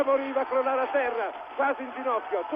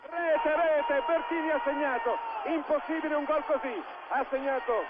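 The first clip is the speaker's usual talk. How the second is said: excited goal call, higher and urgent; breathless goal commentary; 3 semitones higher, slower than usual. Real, not acted. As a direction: shout the goal with joy. Goal just scored, commentator erupts.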